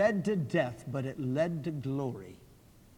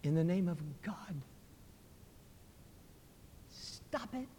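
faint dying cry, very soft; feeble voice; 3 semitones higher, 10 dB quieter than usual. Acted. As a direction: gasp it out weakly with your last strength. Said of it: "he cried out in a feeble voice".

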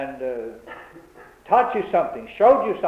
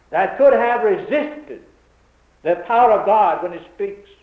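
raised, emphatic preacher's voice; forceful preaching; 5 semitones higher, 5 dB louder than usual. Real, not acted. Preacher insisting no man could resist God's power, forceful preaching. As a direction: drive each phrase home to the congregation.

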